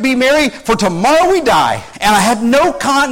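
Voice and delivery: usual speaking voice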